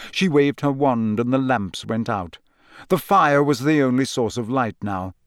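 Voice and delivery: plain reading voice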